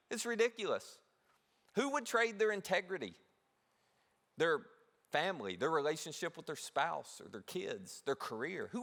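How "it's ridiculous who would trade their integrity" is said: ordinary talk, nothing marked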